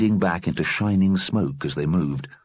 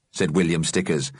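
ordinary delivery

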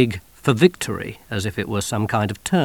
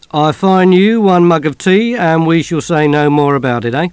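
jovial copper; 5 semitones higher, 9 dB louder than usual. Acted. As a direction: play the friendly bobby. Policeman jokes with soldiers.

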